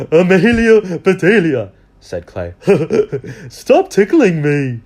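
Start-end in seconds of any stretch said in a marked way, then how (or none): 0.1-1.7 put-on louder, giggly boy calling out; laughing boy. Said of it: "laughing so hard he could not stop". 2.6-3.4 loud giggling fit; boy's helpless laugh. Acted. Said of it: "laughing so hard he could not stop". 3.5-4.8 slow, louder through giggles; laughing plea. Acted. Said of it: "laughing so hard he could not stop".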